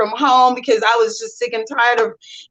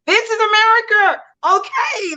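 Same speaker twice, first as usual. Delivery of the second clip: high-pitched, thrilled retold exclamation; excited arrival; 9 semitones higher than usual. Newcomer stepping off the aircraft, excited. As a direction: exclaim it high, as if just landed. Retells awed arrival in America.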